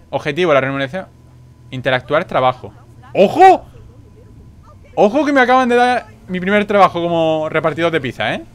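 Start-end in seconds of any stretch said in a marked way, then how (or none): 3.1-6.6 high, loud exclamation; excited shout. Real, not acted. Player landing his first job, excited shout. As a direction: burst out with it, excited.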